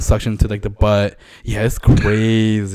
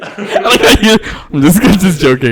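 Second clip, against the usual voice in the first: laughing, higher and louder; 8 semitones higher, 8 dB louder than usual. Real, not acted. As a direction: talk through real laughter. Laughing while answering the jab.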